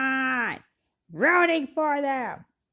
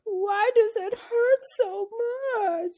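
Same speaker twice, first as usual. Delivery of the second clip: heartbroken quote; 11 semitones higher than usual. Retold or imitated. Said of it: "how many hearts broke".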